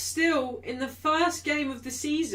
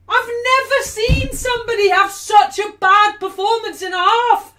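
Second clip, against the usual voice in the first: furious shout; 9 semitones higher, 16 dB louder than usual. Real, not acted. Fan yelling at Carrick to take the player off, furious shouting. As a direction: yell at the top of your lungs, much higher and louder. Fan raging at manager mid-match.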